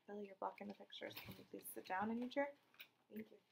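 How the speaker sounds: low, fast hushed correction; quiet aside. Real, not acted. Teacher asking Billy to sit down.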